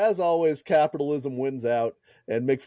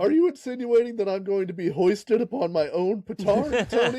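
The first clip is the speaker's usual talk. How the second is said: higher, huffy and grand; pompous mock outrage; 6 semitones higher than usual. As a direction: play the wounded star. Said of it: "acted pompous Foley".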